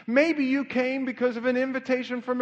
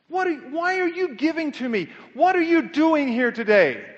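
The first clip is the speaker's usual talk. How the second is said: loud, higher, insistent questioning; confronting challenge; 4 semitones higher, 4 dB louder than usual. Acted. Jesus to the temple crowd, confronting them loudly. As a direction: press each question on the crowd with rising force.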